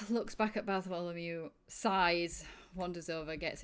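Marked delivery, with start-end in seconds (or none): none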